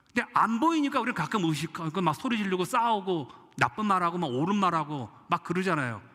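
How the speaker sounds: usual speaking voice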